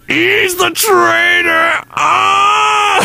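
high, slow shouted accusation; Mace Windu yelling. Retold or imitated. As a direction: yell it like a betrayed Jedi. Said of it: "Mace Windu going".

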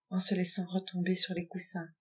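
ordinary delivery, nothing marked

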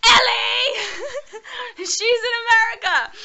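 high laughing shout, near tears; excited shouting. Real, not acted. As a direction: shriek with joy and laughter. Overjoyed reunion with friend.

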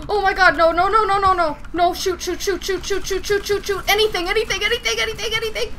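high frantic panicked chanting; terrified horror victim. Real, not acted. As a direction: panic and babble in fear. Panic in chase.

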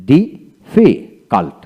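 Difficult very slowly, a gap after each syllable; broken into syllables. Shown as a demonstration. Teacher showing learners how difficult is built, slow and broken up. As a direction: split difficult into separate beats. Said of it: "এইভাবে ভেঙে ভেঙে".

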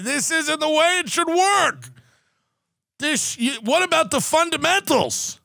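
high, whiny mock lament; mock crying objection. People crying at your scratch-off win, high mock whine. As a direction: pitch it up high like a sore loser. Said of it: "You're going".

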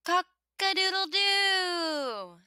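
high, slow, stretched-out rooster crow. A narrator's reading. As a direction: crow it out long like a proud rooster. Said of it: "the rooster crows".

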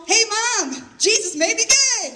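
put-on high, bright-eyed kid news; excited announcement. Telling mom the news after Jesus camp, high and excited. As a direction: blurt it out proudly to mom.

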